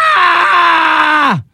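frustrated yell. Real, not acted. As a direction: let out a cry of exasperation. Frustrated cry after rant.

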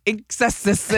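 "Inksessis" with extra hissing syllables; garbled [name]. Retold or imitated. Trivia host retelling a failed correction, garbled. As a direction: trip over it as "ink-sess-iss". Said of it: "I couldn't get it right".

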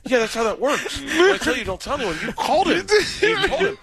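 high, incredulous retold scolding; mock exasperation. Friend scolds him for leaking a secret, mock exasperated. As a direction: imitate an exasperated friend, pitch climbing.

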